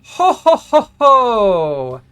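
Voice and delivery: high, loud evil laughing; booming villain laugh. Acted. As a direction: cackle like a gloating wizard. GM voices laughing wizard avatar.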